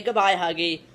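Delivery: normal talking voice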